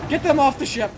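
urgent yell to the crew; shouting. Real, not acted. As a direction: bark the order loudly. Shouting orders on sinking ship.

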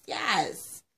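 normal talking voice

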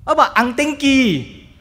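high, loud eager question; eager resident. Retold or imitated. Resident asks about spouse.

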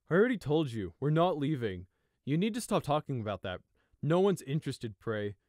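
loud, annoyed scolding; annoyed older brother. Acted. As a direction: shut him down, irritated. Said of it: "Fleece frowned in annoyance".

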